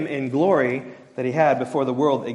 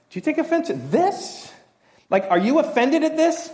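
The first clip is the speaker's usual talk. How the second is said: much higher, pointed question; Jesus challenging; 12 semitones higher than usual. Retold or imitated. Jesus confronting his disciples, high pointed question. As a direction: press them like a challenging teacher. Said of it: "He says".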